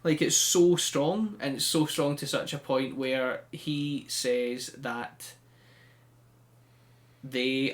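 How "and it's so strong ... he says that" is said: ordinary talk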